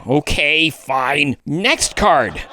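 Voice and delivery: forced smile, clenched jaw; through gritted teeth. Acted. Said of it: "smiling through gritted teeth".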